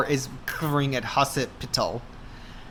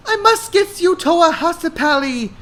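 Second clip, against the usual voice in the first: high put-on girl voice; encouraging; 13 semitones higher, 4 dB louder than usual. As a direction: urge him on in a high voice. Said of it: "Hannah encourages".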